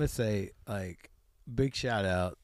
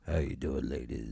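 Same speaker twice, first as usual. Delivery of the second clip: low, soft ladies' man line; smooth honey voice; 10 semitones lower, 7 dB quieter than usual. Pretend. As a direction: drop it low and croon. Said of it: "this smooth honey voice".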